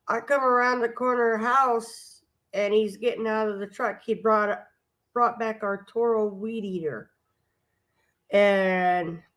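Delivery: ordinary delivery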